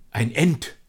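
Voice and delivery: high, loud astonished exclamation; blurted out. A narrator's reading. Charles seeing the walking tree, blurting it out. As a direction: let it burst out in amazement. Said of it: "entfuhr es Charles".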